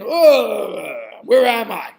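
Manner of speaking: loud, drawn-out groan of confusion; startled waking drunkard. Acted. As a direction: come to with a loud, bewildered moan. Husband woken by thrown water.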